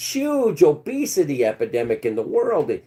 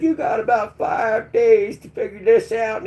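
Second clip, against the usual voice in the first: Trump impression; 9 semitones higher than usual. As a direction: bark the deadline as Trump. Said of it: "Trump said".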